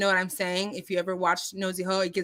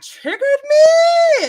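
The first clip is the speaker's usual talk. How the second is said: loud, high, long-drawn shout; exaggerated triggered cry; 11 dB louder, much slower than usual. Telling viewers how the warning set her off, exaggeratedly worked up. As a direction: stretch the last word into a high, loud wail. Exclaims how provoked she was.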